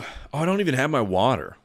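ordinary talk

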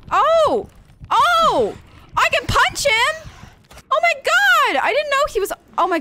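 very high, loud relieved yells; frantic excited cries. Real, not acted. Fighting off creature.

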